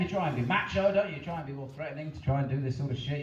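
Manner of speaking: usual speaking voice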